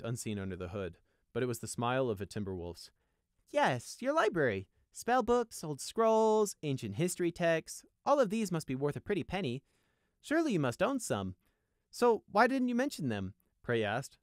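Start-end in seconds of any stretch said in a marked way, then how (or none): 3.5-13.3 high, pointed pressing; loud, pitched-up prodding; needling. A narrator's reading. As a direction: press him with mock-innocent questions. Needling Cedar about his library.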